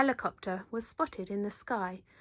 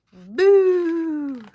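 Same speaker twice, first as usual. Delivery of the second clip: loud high sudden boo; scary boo; 7 semitones higher, 13 dB louder than usual. Acted. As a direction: shout it at the whales. Cub scares the killer whales.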